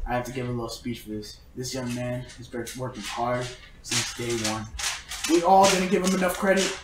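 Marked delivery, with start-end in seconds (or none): 0.1-4.6 low, hushed award speech; speech-giving. Retold or imitated. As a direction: imitate a solemn speech, low and soft. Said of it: "he goes into a speech".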